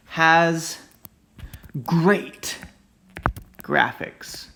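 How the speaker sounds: "great" stressed hard and loud before a falling "graphics"; over-emphasized words. Shown as a demonstration. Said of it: "over-exaggerate".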